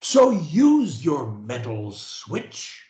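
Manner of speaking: very slow, each word spaced for repeating; slow dictation. Shown as a demonstration. Coach leading a client in repetition, very slow. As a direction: say it slowly for them to copy. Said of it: "say after me".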